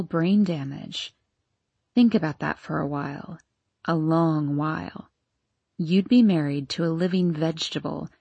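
deep and seductive, in no hurry; deep sultry voice. Acted. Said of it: "said Vera's deep and sultry voice".

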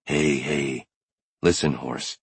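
low, soft calming voice; gentle an animal with a hushed, low tone; soothing. A narrator's reading. Brody calms an unfamiliar mare, soothing low voice. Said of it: "soothing to her at the same time".

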